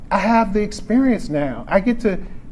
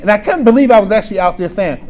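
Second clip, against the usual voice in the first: normal talking voice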